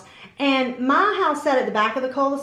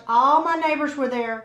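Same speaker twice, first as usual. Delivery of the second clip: normal talking voice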